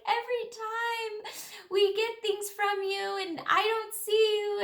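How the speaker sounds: high, emotional, slowing at the end; emotional gush. Retold or imitated. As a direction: pour out how much she's missed you. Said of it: "She's like".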